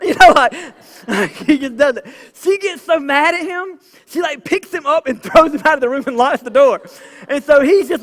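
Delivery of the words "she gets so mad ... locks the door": laughing while retelling. Real, not acted. Retelling daughter's angry reaction.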